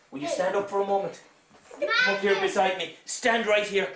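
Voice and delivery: ordinary talk, nothing marked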